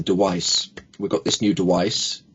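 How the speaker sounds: "device" said as "dewice". Retold or imitated. Coach quotes client muddling sounds, "device" as "dewice". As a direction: put a W in place of the V in "device". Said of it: "V sounds muddled up".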